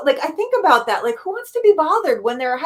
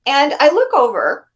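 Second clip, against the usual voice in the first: ordinary delivery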